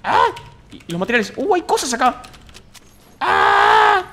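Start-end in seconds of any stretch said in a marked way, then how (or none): 1.4-2.2 high, loud delighted shout; excited discovery. Real, not acted. Finding loot. 3.2-4.0 very high, loud yell; excited scream. Real, not acted. Finding loot.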